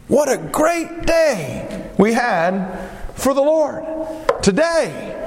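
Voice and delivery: high, drawn-out happy exclamation; smiling joy. Acted. David greeting his household after a great day, smiling joy. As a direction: beam with joy, voice lifted high. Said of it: "with a smile on his face".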